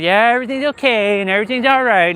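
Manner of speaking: high, breezy fake-fine voice; sing-song pretending everything is fine; mock cheerful bypass. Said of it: "I wasn't gonna bypass".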